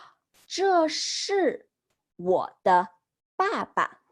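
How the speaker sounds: slow, careful model reading; slow model sentence. Shown as a demonstration. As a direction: say each word slowly for students. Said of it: "So she says".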